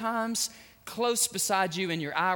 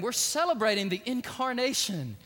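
ordinary delivery

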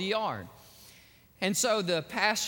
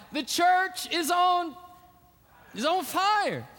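high, loud, drawn-out announcement; acted emergency alert, slow and raised; alarm call; 9 semitones higher, 4 dB louder, slower than usual. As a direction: put the word out urgently. Acts out the emergency call going out.

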